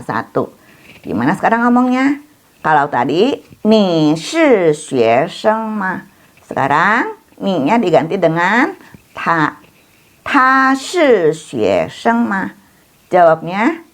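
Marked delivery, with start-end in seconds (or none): 3.7-6.1 very slow, syllable by syllable; slow model reading. Shown as a demonstration. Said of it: "teacher models Chinese question". 10.2-12.5 very slow, syllable by syllable; slow model reading. Shown as a demonstration. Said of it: "teacher models Chinese question".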